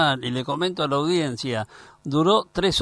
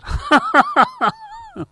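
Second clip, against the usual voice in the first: high laugh; 6 dB louder than usual. Real, not acted. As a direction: burst of high-pitched laughter. Laughs at his complaint.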